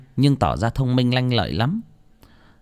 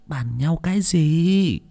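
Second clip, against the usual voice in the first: put-on slow, higher old lady voice; questioning old woman; 7 semitones higher, slower than usual. A narrator's reading. Old lady prompting the stammering boy, slowly questioning. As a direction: draw the question out.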